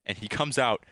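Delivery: ordinary delivery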